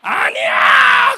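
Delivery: long, drawn-out, very loud shout; big shout. Retold or imitated. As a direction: stretch the denial into a booming yell. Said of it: "강사 목사님이 뭐라고 얘기해요". (길게 끌며 아주 크게 외치는 소리)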